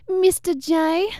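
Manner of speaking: high, slow Boston drawl on Mister J; a bad Boston impression of Harley; terrible Boston accent. Podcast host imitates Harley's first line in Boston accent. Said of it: "I'm doing a terrible Boston".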